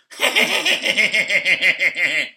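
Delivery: loud, high, bitter burst of laughter; real scornful laugh; harsh laugh. Real, not acted. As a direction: laugh in disbelief. Bitter laugh after quote.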